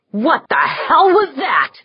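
high, loud startled shout. A narrator's reading. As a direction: yell it out in shock. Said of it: "Jaeger shouted".

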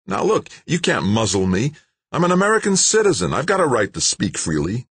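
high, fast, heated defiance; indignant protest. A narrator's reading. Scientist defying the official, indignant. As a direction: stand up for his rights.

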